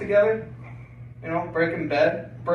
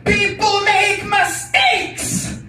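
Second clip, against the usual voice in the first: much higher, slow, louder shout; screaming; 6 dB louder, much slower than usual. Retold or imitated. Mother dragging her kid screams at the guard, slow high shout. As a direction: yell it like a raving lunatic. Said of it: "she screams at me".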